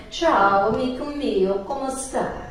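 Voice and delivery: a learner's fond Italian greeting; Italian phrase. Shown as a demonstration. Showing off a Duolingo greeting, in learner's Italian. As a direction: savour the Italian like it's romantic.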